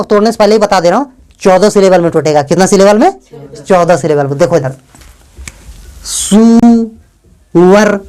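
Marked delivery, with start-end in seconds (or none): 6.0-8.0 सुअर drawn out very slowly in two parts, सु–अर; slow two-beat sounding out of सुअर; syllable breakdown. Shown as a demonstration. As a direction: hold the first vowel of सुअर long, then release the second. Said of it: "इस word को syllable में तोड़ेंगे।".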